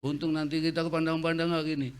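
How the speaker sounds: usual speaking voice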